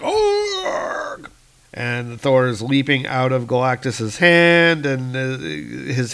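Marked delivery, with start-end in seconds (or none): 0.0-1.3 high, drawn-out cry of pain; mock giant howling in pain; pained roar. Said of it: "Galactus is like".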